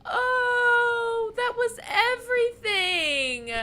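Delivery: high, slow, tearful cry; overwhelmed, crying at the ending; emotional crying. Real, not acted. Moved to tears by game ending.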